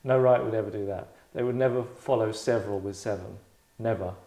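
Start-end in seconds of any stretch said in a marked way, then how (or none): none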